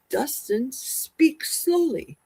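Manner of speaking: deliberately slow, stretching the words; slowed-down talking. Shown as a demonstration. Streamer describes how slowly Dustin speaks, slowed-down talking. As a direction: draw it out to illustrate slow speech. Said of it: "slow voice".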